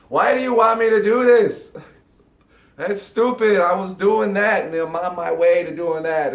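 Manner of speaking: loud, high, indignant protest; mock indignant whining; imitating own irritation. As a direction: complain like a stubborn child. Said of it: "I regularly find myself going".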